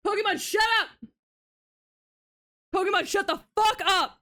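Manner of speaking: giddy furious shouting; excited shout. Real, not acted. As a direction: scream at them to shut up in disbelief. Yelling at Pokemon over news.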